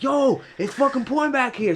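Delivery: high, put-on friend's voice; friend yelling from the back seat. Friend in wet back seat complains, high mock voice. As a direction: mimic your buddy getting soaked in back. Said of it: "He's like".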